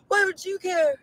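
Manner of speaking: ordinary delivery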